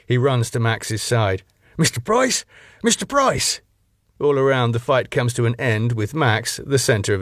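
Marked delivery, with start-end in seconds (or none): 1.8-2.4 high, rising anxious call; worried question. Acted. Doorman over his knocked-out boss, high anxious question. George checks on fallen Max. 2.8-3.6 high, falling urgent cry; alarmed call. Acted. Doorman over his knocked-out boss, high alarmed cry. George calls fallen Max.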